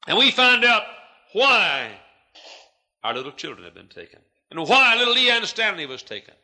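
high, loud, soaring sermon cadence; a preacher thundering his climax; full-force preaching. Real, not acted. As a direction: drive each why home.

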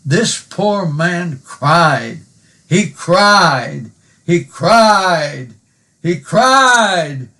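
rising preacher's insistence; building emphasis. Acted. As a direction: preach it, pressing on "cried". Preaching a verse, repeating.

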